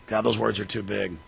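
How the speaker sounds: quiet sarcastic aside; mock aside. Sarcastic aside after big words.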